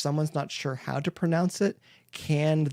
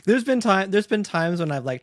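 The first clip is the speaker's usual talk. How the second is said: usual speaking voice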